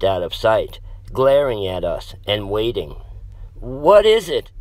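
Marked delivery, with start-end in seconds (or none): 3.5-4.5 whimpering. A narrator's reading. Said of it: "Maddie whimpered".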